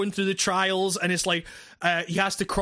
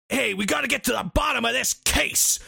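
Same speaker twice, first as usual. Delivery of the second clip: hammed-up TV detective, pitched higher; cliched American cop; 5 semitones higher than usual. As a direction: play the impatient cop from every police show. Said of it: "American cop cliches".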